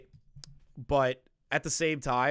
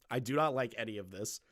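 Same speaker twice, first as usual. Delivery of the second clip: normal talking voice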